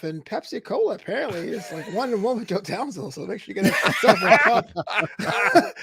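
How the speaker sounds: words broken by real laughter; teasing a friend about his Pepsi poem, laughing; laughing while talking. Real, not acted. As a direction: chuckle your way through the tease. Teases Joe about the Pepsi rhyme.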